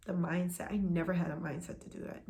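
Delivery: ordinary delivery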